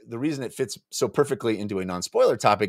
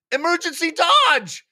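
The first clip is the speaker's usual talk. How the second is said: very high, louder shriek; 4 dB louder than usual. Acted. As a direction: shriek in panic. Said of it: "shrieked Slick Palm".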